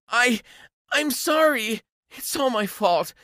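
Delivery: high, pleading apology; desperate gold digger voice; pleading ex-girlfriend. Acted. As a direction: beg him back in a high, small voice. Ex begs forgiveness.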